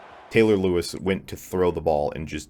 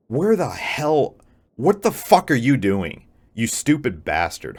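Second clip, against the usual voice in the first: shouting in real anger, much higher and louder; angry outburst; 6 semitones higher, 5 dB louder than usual. Real, not acted. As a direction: yell at the useless defense. Furious at his team's defense.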